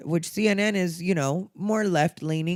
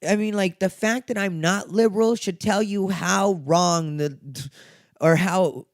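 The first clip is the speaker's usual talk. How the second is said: bothered; 4 semitones higher, 3 dB louder than usual. Real, not acted. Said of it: "I'm a little bothered right now".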